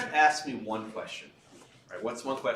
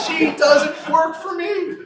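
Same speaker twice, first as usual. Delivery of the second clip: slow, loud, higher, laughing through it; laughing shout; 15 dB louder, much slower than usual. Retold or imitated. Lawyer repeating the governor's line, laughing loudly. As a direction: shout the punchline while cracking up.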